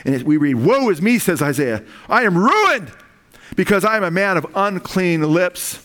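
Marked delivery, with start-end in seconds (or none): 0.5-1.2 raised preacher's cry of woe; anguished cry. Acted. Isaiah convicted before God, anguished cry. 2.1-5.8 high, raised preacher's cry; impassioned lament. Acted. Isaiah confesses unclean lips before God, impassioned lament.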